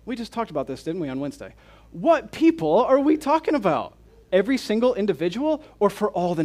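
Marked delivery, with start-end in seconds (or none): 1.9-3.9 pressing question. Real, not acted.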